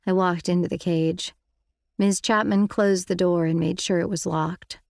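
ordinary delivery, nothing marked

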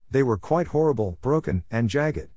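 ordinary delivery

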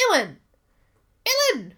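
sharp mock scolding call with falling pitch; imitated angry parents. Imitating parents mad at her, mock-angry calling. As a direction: call the name like a cross parent. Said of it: "when they're mad at me".

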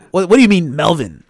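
loud, baffled disbelief; incredulous reaction. Retold or imitated. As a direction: demand an explanation, loudly. Acting out own baffled reaction.

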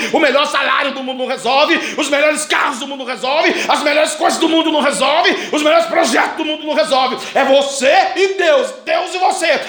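climbing sermon cry; preaching. Acted. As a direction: hammer each line home. Preaching at full force.

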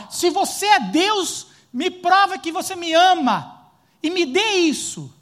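slow, high, loud mock challenge; demanding believer. Pastor voicing a believer daring God to prove love, slow and demanding.

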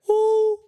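long, very high feedback hoot; sustained high "uuh" squeal; imitated mic feedback. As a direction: imitate the howl of a feeding-back mic. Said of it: "El típico acople de después".

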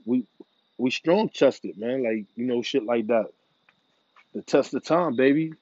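ordinary delivery

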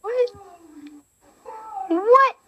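rising squeaky what. Acted. Escalating what exchange.

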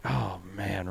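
very soft, deflated muttering; quiet mock disappointment; retold dismay. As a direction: say it under your breath, let down. Retold dismay at going wrong place.

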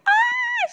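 high, loud imitated squeal; excited child's squeal. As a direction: squeal like a thrilled little girl. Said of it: "She's just so excited".

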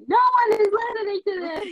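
fast, high, loud mocking quote; mock her whiny complaint; mocking imitation. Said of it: "after you said".

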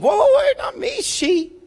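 high, panicky mock protest; Adam and Eve blaming. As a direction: squeal out the excuses like a caught kid passing blame. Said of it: "here's the response of Adam and Eve".

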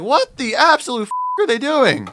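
high, loud disbelieving outburst; shocked outcry. Retold or imitated. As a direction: nearly shout what the absolute. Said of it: "And I'm like".

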